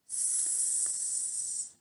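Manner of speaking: S as a long unvoiced hiss; snake hiss sound. Shown as a demonstration. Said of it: "This is the sound".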